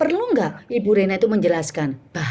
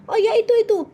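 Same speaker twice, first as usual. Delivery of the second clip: high, hurried offhand pointing-out; wrong presenter manner; 12 semitones higher, 3 dB louder, faster than usual. Shown as a demonstration. Coach showing how not to point, high offhand manner. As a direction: toss it off high and casually. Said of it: "itu ini bukan begini".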